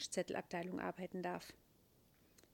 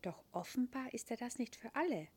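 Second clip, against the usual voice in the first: usual speaking voice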